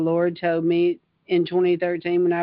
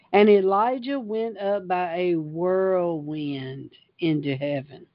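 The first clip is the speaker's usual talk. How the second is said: ordinary talk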